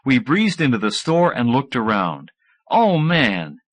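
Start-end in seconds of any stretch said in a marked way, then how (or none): none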